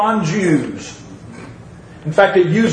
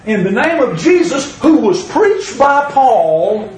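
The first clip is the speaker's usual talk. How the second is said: mock exorcist's command; 6 semitones higher, 4 dB louder than usual. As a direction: intone it like a self-important exorcist. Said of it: "And they said".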